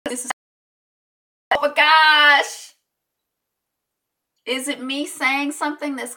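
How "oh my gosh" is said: high, loud, drawn-out exclamation; exasperated outburst. Real, not acted. Speaker hit by a bad connection, exasperated outburst. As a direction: stretch it out slowly, pitched high and loud.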